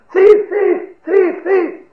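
very high, loud shouts; joining the outcry. Acted. Man joining the crowd's chase, crying out loudly. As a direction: holler it out high, over and over. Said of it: "He's also joined them".

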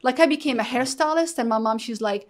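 ordinary talk

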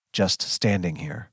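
plain reading voice

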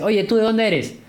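lightly voiced guy approaching fans with a question; curious interviewer. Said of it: "un chico que se acerca a estos fans y dice". (chico curioso, imitado con voz más aguda)

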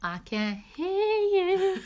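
high, drawn-out mock call; playful singsong call. Host teasing the unheard listeners, playful high call. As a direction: stretch it out high, ending in a laugh. Joking echo of can't hear them.

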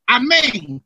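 high amen falling away; comic amen. Retold or imitated. Simplicio answering the brother's blessing, comic amen.

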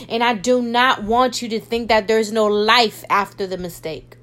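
normal talking voice

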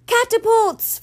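sharp high warning yell. A narrator's reading. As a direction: shout it out as the missiles fly. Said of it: "Florence yelled".

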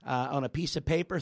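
ordinary delivery

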